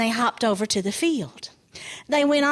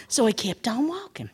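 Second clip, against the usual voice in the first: plain reading voice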